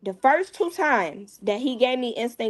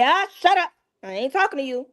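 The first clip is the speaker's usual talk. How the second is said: put-on sharp, higher and louder, cutting the boy off; angry snap; 5 semitones higher, 3 dB louder than usual. Mother cuts off the boy who denies it, angry and loud. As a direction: shut him down hard, high and loud.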